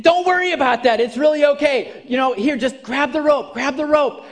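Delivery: calling down. Retold or imitated. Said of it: "the captain's like".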